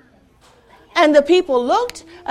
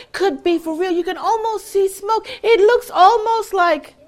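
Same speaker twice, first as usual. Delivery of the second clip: higher, wondering gasps of amazement; amazed crowd; 6 semitones higher than usual. Acted. Villagers marvelling at the painted dragon, amazed.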